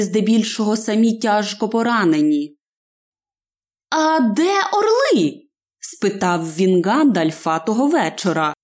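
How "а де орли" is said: high, drawn-out question; hobbit's questioning voice. A narrator's reading. Hobbit asks wizard about eagles from under blankets, high questioning voice. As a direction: ask in a higher, slower hobbit voice.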